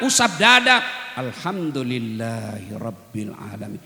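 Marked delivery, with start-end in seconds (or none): 1.1-3.8 low, slow, soft thanksgiving; gentle grateful murmur; heartfelt and slow. Shown as a demonstration. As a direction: savour it gratefully. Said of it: "Coba ucapkan dengan lapang dada".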